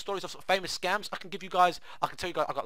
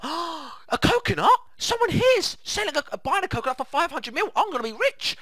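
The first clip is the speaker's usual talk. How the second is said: high, loud mock gasp of greed; greedy excitement; 14 semitones higher, 6 dB louder than usual. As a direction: play the victim who smells a fortune.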